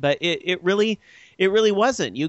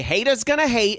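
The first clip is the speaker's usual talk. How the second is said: higher-pitched trashy talk-show imitation; talk-show guest sass; 9 semitones higher than usual. Host mimics Maury Povich show guest, mock sass. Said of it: "something you hear on the Maury Povich show".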